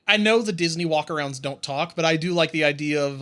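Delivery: ordinary delivery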